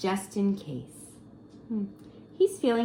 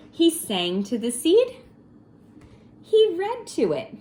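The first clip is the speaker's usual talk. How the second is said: lively read; 6 semitones higher, 7 dB louder than usual. A narrator's reading. Read-aloud building excitement.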